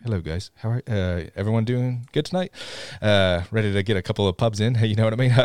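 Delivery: casual bloke, voice dropped and softened; deepened British voice. Acted. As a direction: quietly pass yourself off as British. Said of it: "you sound like Korg".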